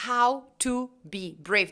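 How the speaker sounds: slow, separate HOW, TO, BE, BRAVE; word by word. Shown as a demonstration. Teacher shows the written phrase separately.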